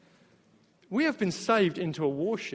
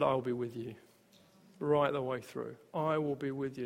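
gentle promise; 7 semitones lower, 10 dB quieter than usual. Retold or imitated. Quoting Jesus' promise softly.

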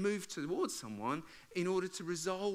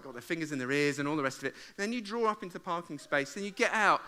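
normal talking voice

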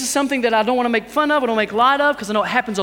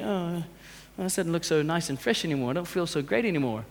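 lower, softer, deflated grumble; mock disappointment; 7 semitones lower, 10 dB quieter than usual. Preacher acting out a let-down shirt owner, low mock disappointment. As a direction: let down, voiced low and soft.

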